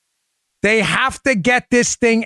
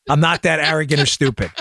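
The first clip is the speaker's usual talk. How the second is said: ordinary talk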